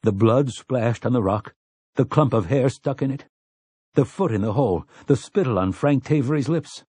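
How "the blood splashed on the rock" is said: ordinary delivery, nothing marked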